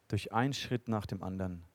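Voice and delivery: leise eindringlich. Real, not acted. Eindringlicher Predigtschluss.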